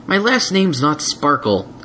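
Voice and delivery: slow, grumpy correction; sulky. Acted. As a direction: sulk. Spike sulky.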